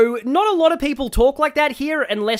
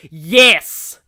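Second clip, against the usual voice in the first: excited yes; 9 dB louder than usual. Real, not acted. Chat suggests Van Damme cast.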